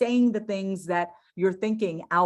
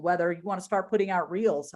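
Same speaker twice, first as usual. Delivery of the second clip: normal talking voice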